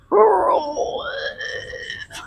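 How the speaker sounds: high, wailing zombie groan; zombie moan. Pretend. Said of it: "this is my zombie impression".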